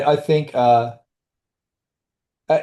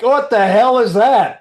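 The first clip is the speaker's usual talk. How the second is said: startled exclamation; 11 semitones higher, 6 dB louder, slower than usual. Retold or imitated. Retold moment of sudden alarm.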